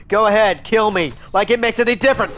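high, loud yelling in frustration; real gamer rage, shouted high; angry shout. Real, not acted. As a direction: scream it at the killer, done caring. Killed in game, raging.

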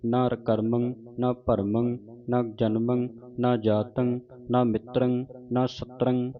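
slow, measured chanting of gurbani; recitation. Shown as a demonstration. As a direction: recite each line as a chant.

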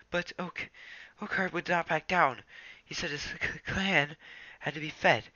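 wounded and frightened; shaky Ravenpaw. Acted. Ravenpaw reports.

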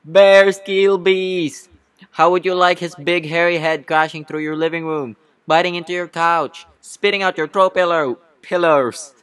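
loud, high courtroom tirade; shouted rant. Acted. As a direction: shout the threat with theatrical fury. Bee rants at the witness.

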